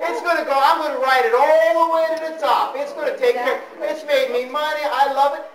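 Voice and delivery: high, hammed-up protest from a devoted stockholder; indignant, smitten investor. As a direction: mimic a stubborn investor refusing to sell. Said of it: "What does Gerda say to me?".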